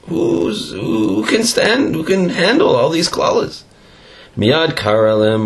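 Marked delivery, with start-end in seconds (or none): 0.1-3.6 frightened people. Retold or imitated.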